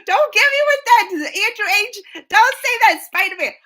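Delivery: high, loud excited scolding; hammed-up high outburst; mock outraged shriek. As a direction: squeal at the chatter in mock protest. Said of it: "I was like".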